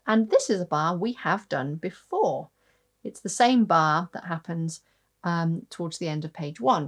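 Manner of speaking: ordinary talk, nothing marked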